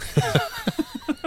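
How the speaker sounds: a quick airy giggle up high; high breathy laugh. Real, not acted. Host laughing at his co-host's joke, airy giggle. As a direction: let a soft high laugh escape.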